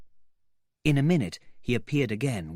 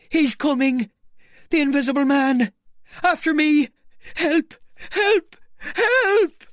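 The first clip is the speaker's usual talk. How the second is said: high, loud, frantic cries for help; voice cracking with terror; 9 dB louder than usual. Acted. Marvel begging the inn to save him, terrified screaming. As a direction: shriek it as he flees for his life. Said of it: "The voice cracked with terror".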